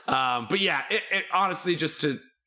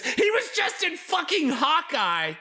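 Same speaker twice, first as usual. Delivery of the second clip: much higher, exuberant bragging; real excited hype; slower than usual. Real, not acted. Host hyping a castmate's Hawkeye role, real excitement.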